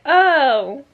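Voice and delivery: usual speaking voice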